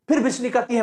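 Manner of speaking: plain reading voice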